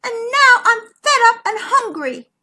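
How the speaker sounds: high, loud, sulky whine; sniffy. A narrator's reading. Zara complains to her cousin, sniffing sulkily. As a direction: whine it like a fed-up child. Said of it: "sniffed Zara".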